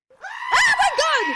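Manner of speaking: real high, loud shriek of shock; very high, loud startled yell; startled scream. Real, not acted. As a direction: scream out as if jolted by a blast. Startled by sudden very loud sound.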